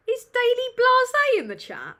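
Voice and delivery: loud, high-pitched excited shout; excited call-out. Real, not acted. Streamer spots a friend in the chat, excited call-out.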